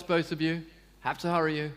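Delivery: ordinary talk, nothing marked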